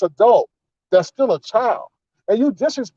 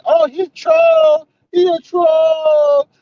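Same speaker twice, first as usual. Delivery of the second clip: high, slow mocking singsong; mocking the panel; 8 semitones higher, slower than usual. Commentator mocks the panelists excusing the guest, high and slow. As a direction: drag it out high like silly hangers-on. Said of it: "they were trying to validate, like".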